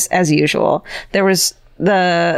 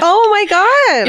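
shocked; 13 semitones higher, 3 dB louder, much slower than usual. Real, not acted. Shocked at ghost photo reveal.

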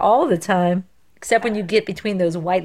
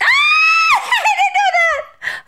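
surprised shriek; 4 dB louder than usual. Real, not acted. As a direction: squeal it out in disbelief. Surprised by trivia answer.

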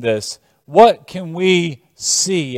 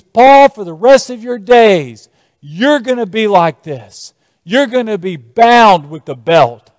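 prophet's declaration; 5 semitones higher, 5 dB louder than usual. Acted. Prophet binding himself, declaring Paul's fate.

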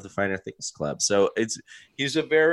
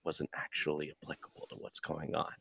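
much softer, leaning-in quiet delivery; quietly; 5 semitones lower, 12 dB quieter than usual. Retold or imitated. Host imitating Oscar quietly correcting Kevin's joke, much softer. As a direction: lean in and say it under your breath. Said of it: "leans in and says it so quietly".

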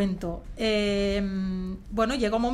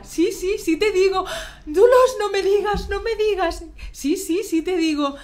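high and louder, laughing at the end; excited squeal; 10 semitones higher, 8 dB louder than usual. Retold or imitated. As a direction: mimic the giddy back-and-forth. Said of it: "Y ella". (chillido emocionado y agudo, con risa al final)

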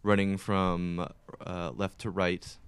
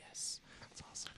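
hushed, satisfied whisper; gleeful whisper; 19 dB quieter than usual. Retold or imitated. Gamer whispering delight as the spider bleeds. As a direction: savor the win under your breath. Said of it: "you're just like".

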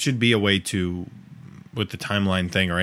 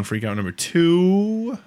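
mock announcement; 7 semitones higher, much slower than usual. Announcing the next freakout.

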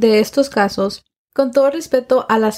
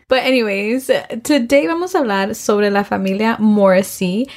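ordinary delivery

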